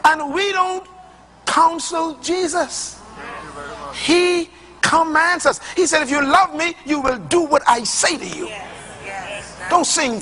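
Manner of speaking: high, slow, weighty pulpit delivery; full-force preaching. Acted. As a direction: drag out counsel Jesus for weight. Preaching on the king's commands.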